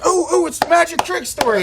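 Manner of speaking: high-pitched, giddy squeal of recognition; hammed-up eager listener; excited fan. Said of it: "somebody up there that was like".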